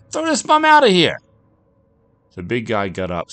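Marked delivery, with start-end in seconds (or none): 0.1-1.2 very high, loud angry order; shouted. A narrator's reading. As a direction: yell the order across the bar. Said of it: "he shouted at a large man".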